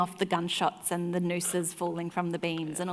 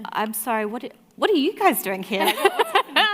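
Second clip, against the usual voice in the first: rising, louder and higher in disbelief; mock panicked bewilderment; bewildered; 7 semitones higher, 9 dB louder than usual. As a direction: blurt out total confusion. Her bewildered question.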